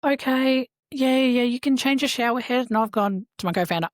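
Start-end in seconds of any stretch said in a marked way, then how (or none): 0.0-2.7 caving in. Retold or imitated. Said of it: "I could hear her going".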